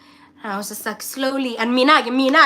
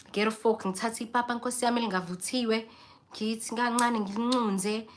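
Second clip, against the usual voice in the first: ordinary talk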